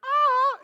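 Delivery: high, loud mock squeal; imitated delighted gasp. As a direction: squeal like a thrilled girlfriend, played up. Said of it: "she would go like".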